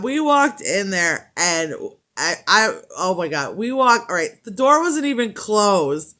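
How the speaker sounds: ordinary talk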